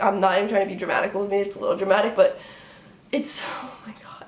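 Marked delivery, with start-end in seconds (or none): 3.1-4.2 soft exasperated whisper, almost no voice; whispered despair. Real, not acted. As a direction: breathe it out like you're defeated. Exasperated fan gives up.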